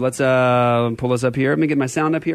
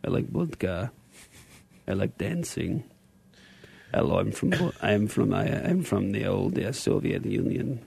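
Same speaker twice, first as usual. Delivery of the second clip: mock Russian accent; 8 semitones lower, 10 dB quieter than usual. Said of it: "how you like my Russian?".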